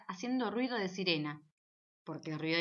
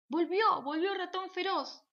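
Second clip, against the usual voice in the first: put-on frightened children yelling, higher; panicked shout; 9 semitones higher than usual. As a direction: shout the warning as you run to hide. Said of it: "gritaban los amigos".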